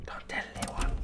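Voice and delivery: breathy secret whisper. Acted. Whispered aside while playing.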